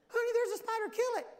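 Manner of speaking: very high-pitched mock female alarm; hammed-up high, urgent plea; panicked wife. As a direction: squeal it like a wife who's spotted a bug. Acts wife panicking over spider.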